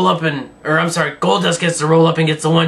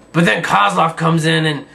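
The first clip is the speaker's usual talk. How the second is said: normal talking voice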